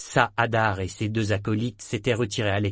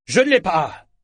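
loud, high furious cry; 8 semitones higher, 8 dB louder than usual. Acted. Saadar denying he has the chest, furious outcry. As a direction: hurl the denial back. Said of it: "s'exclama Saadar furieux".

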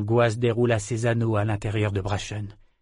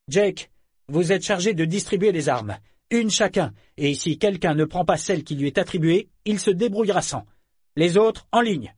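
higher, clipped captain's orders; harsh barking commands; 6 semitones higher than usual. A narrator's reading. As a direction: bark orders at the crew. Captain barks orders at crew.

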